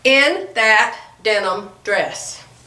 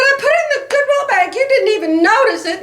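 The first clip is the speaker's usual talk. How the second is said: the words "I put it in the Goodwill bag" repeated much higher and fast; exasperated repeat; 11 semitones higher than usual. Retold or imitated. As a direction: throw "I put it in the Goodwill bag" back at him, half-whining. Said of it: "And I said".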